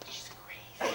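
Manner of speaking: unvoiced whisper with a laugh. Dad whispering to his daughter about the bald lady, whisper. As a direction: whisper it behind your hand. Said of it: "he whispered it".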